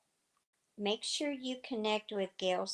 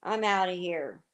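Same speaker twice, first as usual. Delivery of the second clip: louder huffy exit line; retold brush-off; 7 dB louder than usual. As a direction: toss it off loudly like storming out. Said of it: "He just goes".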